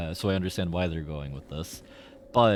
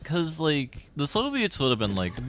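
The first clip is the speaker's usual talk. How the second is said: usual speaking voice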